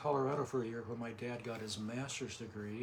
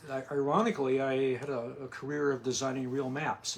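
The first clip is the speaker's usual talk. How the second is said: louder, projecting after being asked; raised, projected conversational voice; speaking up; 6 dB louder than usual. Real, not acted. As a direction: speak up as if to someone hard of hearing. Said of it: "Talk, talk as if I'm deaf".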